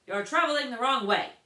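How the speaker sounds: loud, high stern guard. A narrator's reading. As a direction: announce it loudly. Guard announces.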